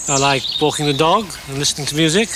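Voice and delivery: usual speaking voice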